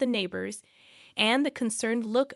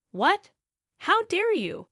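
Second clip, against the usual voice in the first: high, loud indignant outburst; outraged; 10 semitones higher, 5 dB louder, slower than usual. A narrator's reading. Mother-in-law reacts to wife refusing to clean, outraged. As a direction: react with offended disbelief, voice jumping up.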